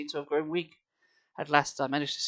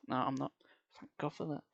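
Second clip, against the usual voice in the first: ordinary delivery, nothing marked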